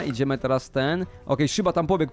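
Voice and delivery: usual speaking voice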